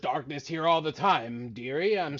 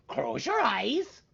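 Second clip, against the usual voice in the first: card magician patter; 5 semitones higher, much slower than usual. Acted. Magician instruction.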